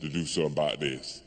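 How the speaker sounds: low, hushed voice of the whiskey; soft, deep-pitched nudge from the booze; Jack Daniels talking. Acted. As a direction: egg him on quietly, low and confidential. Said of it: "all that Jack Daniels I was drinking said".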